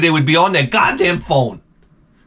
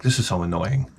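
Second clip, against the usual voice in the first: muttered aside; 5 semitones lower, 7 dB quieter than usual. Retold or imitated. His muttered inner thought.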